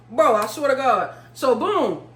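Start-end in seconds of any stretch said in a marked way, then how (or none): none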